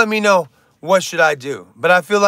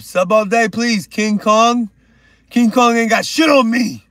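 hype shout; 5 semitones higher, 5 dB louder than usual. Real, not acted. Hyping a new sub.

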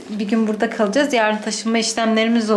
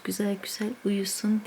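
soft unvoiced whisper; 2 semitones lower, 9 dB quieter than usual. Real, not acted. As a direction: breathe the words without voice. Whisper over sleeping child.

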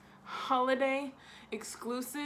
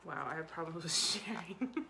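whispered aside; 5 semitones lower, 5 dB quieter than usual. Real, not acted. Joking aside.

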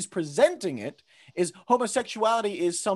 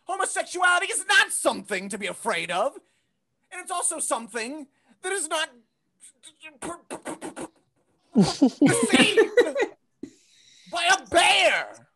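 mock outraged rant; 10 semitones higher than usual. Comic lecturing his mother, peaking in a shout. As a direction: rant that erupts on the last words.

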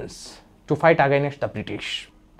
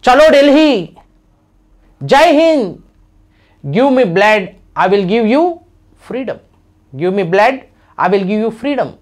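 loud, higher battle cry; rally the crowd with the slogan; rousing slogan; 4 semitones higher, 5 dB louder than usual. Retold or imitated. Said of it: "he gave a great slogan".

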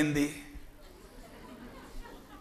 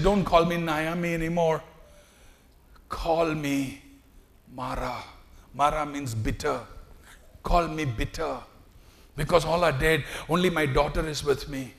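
bitter grief; 2 semitones lower, 6 dB quieter than usual. Acted. Grieving Naomi.

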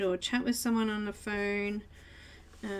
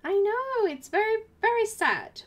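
mock pity; 11 semitones higher, 6 dB louder than usual. Mock pity after self-joke.

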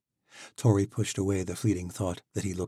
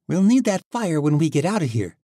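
lightly voiced Misha; 9 semitones higher, 8 dB louder than usual. Misha answers Tori.

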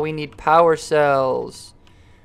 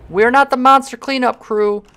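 ordinary talk, nothing marked

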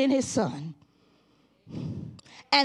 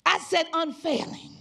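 loud, rising revival preaching; preaching at full force; 5 semitones higher, 4 dB louder than usual. Acted. Pastor exhorting the congregation, loud full-force preaching.

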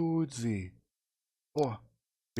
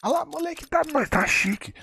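much higher thrilled shout; excited squeal; 3 dB louder than usual. Real, not acted. Streamer celebrates a big drop, squealing. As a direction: squeal with delight.

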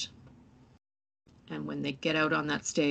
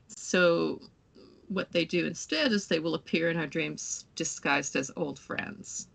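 ordinary talk, nothing marked

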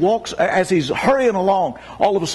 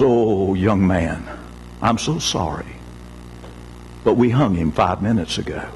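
low, sorrowful jailer breaking bad news; weeping jailer; 11 semitones lower than usual. Jailer sorrowfully tells the servant the man was hanged, low and grieving. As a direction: let the grief weigh down each word.

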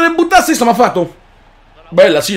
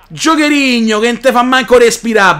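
ordinary delivery

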